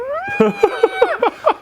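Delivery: very high screaming bugle; imitated elk bugle. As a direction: rip out a squealing bull elk call. Imitates the bull's close bugle.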